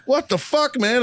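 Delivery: very high-pitched retold protest; mock exasperation. Sergeant to the Marine who outran gunny, mock exasperated. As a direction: imitate yourself in exasperated disbelief.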